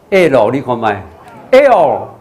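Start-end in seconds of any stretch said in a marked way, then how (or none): 1.5-2.1 "L" with tongue tip lifted to the palate; curled-tongue L. Shown as a demonstration. Teacher models the letter for learners, curled-tongue "L". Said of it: "先发出A的声音，那发O，那卷舌要".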